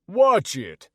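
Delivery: loud warning from a stranger; put-on tough character voice; gruff warning voice. A narrator's reading. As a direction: bark it at someone in the way. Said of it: "came a deep voice from behind me".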